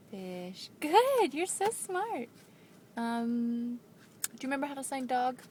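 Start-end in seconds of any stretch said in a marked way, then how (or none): 0.8-2.3 high, loud praise; excited praise. Real, not acted. Praising child's sign.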